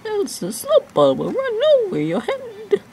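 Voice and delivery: hammed-up squeaky warning, slowing at the end; silly high voice. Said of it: "Why am I talking like this?".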